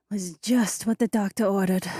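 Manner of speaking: high, contented woman's voice; satisfied woman's voice. A narrator's reading. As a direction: purr it, fully satisfied. Alessia, satisfied afterwards.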